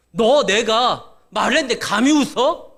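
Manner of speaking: loud, slow scolding; stern rebuke. Acted. God scolding Sarah for daring to laugh, loud indignant rebuke. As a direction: thunder it slowly, affronted at being doubted.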